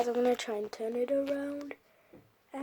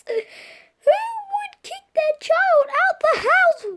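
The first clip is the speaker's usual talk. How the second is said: slow, high, loud whining cry; slow, loud, high mock-crying protest; mock outrage; 10 dB louder, much slower than usual. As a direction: wail it in mock disbelief. Outraged at the dad.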